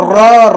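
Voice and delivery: ر as a loud trilled "ra"; isolated letter sound. Shown as a demonstration. Teacher shows tongue's part, loud rolled ر. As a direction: hit ر hard with a rolled R. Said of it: "ر کی آواز نکالنے میں زبان شامل ہے".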